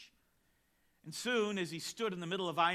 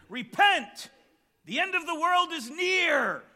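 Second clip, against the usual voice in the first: high, very loud doomsday yell; imitated preaching scream; 10 semitones higher, 11 dB louder than usual. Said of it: "he started to scream".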